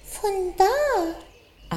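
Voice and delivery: high tiny bunny voice; little rabbit voice. A narrator's reading. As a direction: squeak it like a small rabbit.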